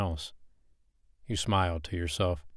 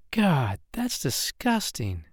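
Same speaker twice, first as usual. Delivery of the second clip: slow, higher voice full of disgust; 7 semitones higher, slower than usual. Acted. Woman watching the snake being skinned, slow disgust. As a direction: drag out the revulsion.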